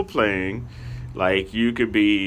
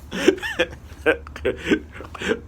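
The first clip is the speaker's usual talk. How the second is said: helpless high wheezing laugh; real laughter. Real, not acted. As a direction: break down laughing at your own story. Cracks up at his own story.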